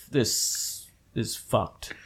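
low, slow stunned murmur; stunned mutter. Retold or imitated. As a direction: let it sink in, dazed and hushed. Reaction to watching Old Boy.

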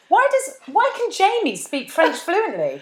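high-pitched, drawn-out mock bafflement; baffled questioning. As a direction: act out an incredulous viewer's question. Re-enacts own baffled reaction to show.

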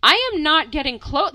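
a mock-panicked loud no to mom; retold refusal. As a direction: put your foot down, loudly. Refusing mom near owl.

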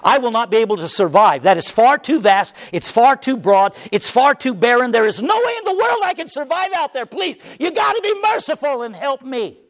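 rising, desperate plea to the Lord; pleading Cain. Acted. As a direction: beg God not to cast you out. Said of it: "Cain cried out to the Lord".

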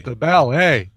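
"ballet" with both syllables weighted; stress on both syllables. Shown as a demonstration. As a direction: give each syllable of "ballet" its own punch. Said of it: "you kind of, uh, did it in both".

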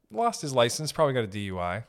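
normal talking voice

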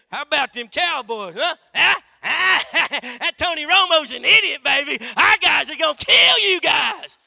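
loud, high, rowdy fan; hammed-up sports bragging; football trash talk. A churchgoer brags about his team to the pastor, loud rowdy gloating.

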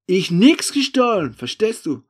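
slow, high, very loud denial; angry denial. Acted. The accused man answering the threat, angry denial. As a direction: hammer out the denial at a shout.

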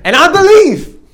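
high, loud declaration; hammed-up defiant proclamation; sassy prayer. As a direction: declare it high and forceful, with sass. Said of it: "I prayed the sassiest prayer".

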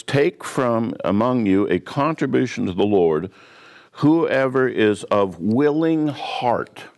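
preacher dwells slowly on "willing heart"; stress on willing heart. Shown as a demonstration. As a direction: lean hard on "willing heart", stretching each word. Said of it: "And that is the key phrase".

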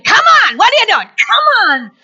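high, urgent, punchy prodding; spurring someone on. Acted. Preacher acts out spurring a slacker, high urgent prodding. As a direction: goad them into moving, pitched high. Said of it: "Sometimes it's a spurring".

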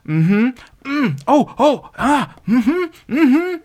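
high, loud mock reactions; hammed-up audience hums and gasps; imitating noisy moviegoer. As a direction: react loudly to every twist, mockingly. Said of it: "when she was just like".

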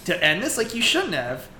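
tongue-in-cheek showboating; mock-modest swagger. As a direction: act out a cocky hero basking in attention. Retelling smug hero's grand entrance.

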